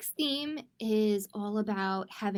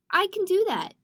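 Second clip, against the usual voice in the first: higher, louder eager boast; confident boast; 7 semitones higher, 5 dB louder than usual. Acted. Trini boasting eagerly before building her castle. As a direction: say it like a proud little gymnast.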